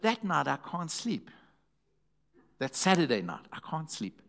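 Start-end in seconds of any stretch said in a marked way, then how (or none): none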